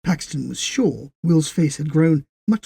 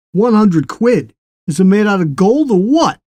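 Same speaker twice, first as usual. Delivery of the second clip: incredulous; 7 semitones higher, 10 dB louder than usual. A narrator's reading. Les scoffing at the bicycle's price, incredulous. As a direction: stretch the outrage at the price.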